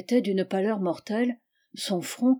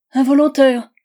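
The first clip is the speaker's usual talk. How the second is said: éclat aigu et fort; exclamation indignée; 5 semitones higher, 11 dB louder than usual. Acted. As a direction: rejeter le mot avec mépris. Charlotte relève le mot indignée. (Charlotte répète le mot de Georges, indignée)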